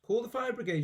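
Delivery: put-on high panicked old woman shout; grandma burning inside, sudden shout. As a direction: shout out in alarm. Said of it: "she shouted suddenly".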